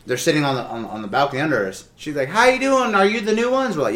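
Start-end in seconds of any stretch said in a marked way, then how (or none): 2.3-3.8 put-on higher, sing-song older woman greeting; chirpy older neighbor lady. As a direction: play the friendly nosy neighbor.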